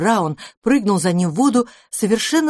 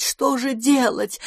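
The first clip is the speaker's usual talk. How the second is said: паника нарастает, выше и медленно; метаться и повторять всё тревожнее; в панике; 6 semitones higher, slower than usual. A narrator's reading. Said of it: "заламывая лапы и приговаривая". (паническое причитание, выше)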